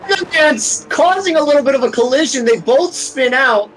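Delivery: high, urgent race call; excited. Real, not acted. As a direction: call the crash with rising alarm. Collision in race commentary.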